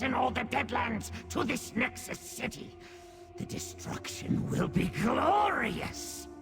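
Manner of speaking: high, swooping villainous exultation; gloating relish. Acted. Daedrat relishing Fargrave's destruction, gloating and high. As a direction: revel in the coming doom. Gloats over coming invasion.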